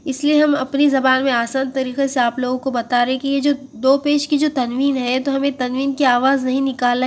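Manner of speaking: usual speaking voice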